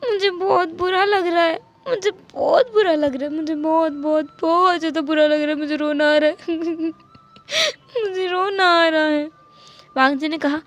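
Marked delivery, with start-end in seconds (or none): 0.1-9.3 put-on childish crying voice, higher; tearful childlike whine. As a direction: whimper through tears like a little kid. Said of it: "wing ने रोते हुए कहा".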